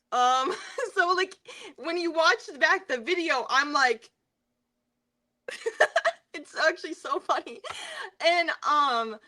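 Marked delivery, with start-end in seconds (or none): 5.5-7.6 laughing while talking, pitched up; cracking up at a funny memory; real amused laughing. Real, not acted. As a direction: giggle through the words. Cracks up recalling the video.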